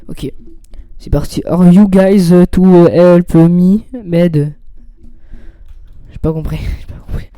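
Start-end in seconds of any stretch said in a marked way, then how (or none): 1.5-4.5 loud, halting English, French accent; choppy French-accented English. Real, not acted. Streamer reading Bob's line aloud, choppy French-accented English. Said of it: "mon accent est un peu trop haché". 6.8-7.4 soft, breaking into a laugh. Real, not acted. Streamer confessing he didn't understand his own reading, laughing.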